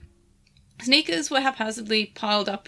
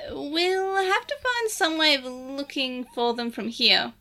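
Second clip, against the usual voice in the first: slow, higher creature voice; lightly voiced Digimon sidekick; Lugumon voice; 4 semitones higher, slower than usual. Lugumon character voice.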